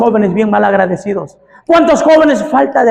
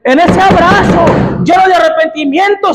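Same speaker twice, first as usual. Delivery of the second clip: "En ese abrazo" gritado, alto y agudo; clamado; 7 semitones higher, 3 dB louder than usual. Acted. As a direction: proclama "abrazo" con fuerza. Loud take of repeated phrase. ("En ese abrazo" clamado a voz en cuello)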